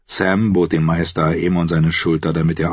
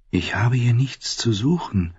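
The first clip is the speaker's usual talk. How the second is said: nervous thought; 5 semitones higher, 3 dB quieter than usual. A narrator's reading. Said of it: "dachte Sam nervös".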